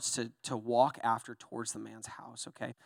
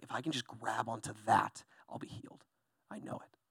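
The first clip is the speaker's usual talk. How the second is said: much softer, sinking into a breathless whisper; hushed hopeful whisper; 2 semitones lower, 6 dB quieter than usual. Acted. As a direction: whisper it as a secret hope. Woman's secret hope, hushed.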